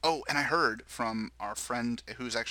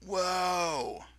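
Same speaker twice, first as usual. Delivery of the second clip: higher-pitched "whoa" in a mock British accent; 9 semitones higher, 3 dB louder than usual. Podcast host imagines the listeners' reaction, mock British accent. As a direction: put on a British accent for the exclamation. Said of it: "in a British accent".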